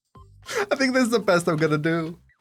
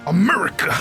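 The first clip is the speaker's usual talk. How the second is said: patriotic exclamation; 3 dB louder than usual. Pretend. Repeats eagle's name with gusto.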